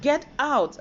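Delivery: very high, stunned; shocked disbelief. Retold or imitated. Told to leave the office, stunned and high. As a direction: gasp out the question in shock. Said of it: "I was like".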